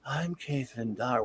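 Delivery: slow, soft woman's introduction; understated movie-character imitation; film character's voice. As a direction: lightly imitate her slow, quiet introduction. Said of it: "her voice is".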